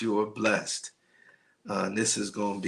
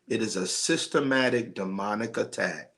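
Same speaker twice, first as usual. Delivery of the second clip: normal talking voice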